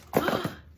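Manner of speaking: sharp gasp of surprise; delighted gasp. Real, not acted. Collector unwrapping a gift, delighted gasp. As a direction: gasp at a lovely find.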